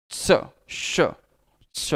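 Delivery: श with tongue touching the palate as in च; palatal श. Shown as a demonstration. Teacher drilling the palatal sound, श whistled off the palate. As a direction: start from च's position, hiss out श. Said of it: "जीभ ऊपर तालू को स्पर्श".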